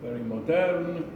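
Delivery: normal talking voice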